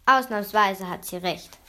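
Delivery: plain reading voice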